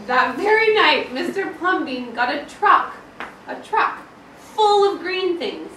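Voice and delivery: animated read-aloud; reading to children. A narrator's reading. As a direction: read it out with energy for the kids. Teacher reading aloud to class.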